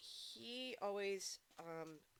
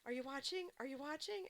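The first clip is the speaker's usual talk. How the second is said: higher, eager imitating voice; excited imitation; 7 semitones higher than usual. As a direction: bounce with eagerness to show it off. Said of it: "was all excited about it".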